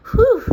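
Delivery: big high exhale of relief; loud relieved whew. Real, not acted. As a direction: blow out the tension. Relief after money worry.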